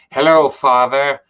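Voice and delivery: slow, grand mock-formal hello; solemn greeting. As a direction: greet him like a ceremony. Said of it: "Do I just go".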